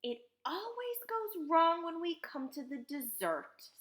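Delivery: high-pitched, resigned lament; rueful inventor; sighed. A narrator's reading. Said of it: "sighed Mister Wonka".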